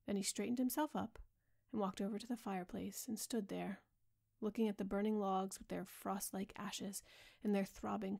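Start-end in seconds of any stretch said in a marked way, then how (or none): none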